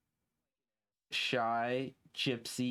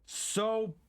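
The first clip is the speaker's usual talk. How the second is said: exaggerated angry outburst; 9 semitones higher than usual. Real, not acted. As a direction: blow up over the ruined puzzle. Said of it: "so pissed".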